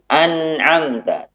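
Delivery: slow "An'amta" with a short hummed n; slight flow on nun. Shown as a demonstration. Teacher repeating the correct nun, slowly. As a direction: give the n of "An'amta" a brief hum. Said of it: "Ada aliran suara, namun sedikit".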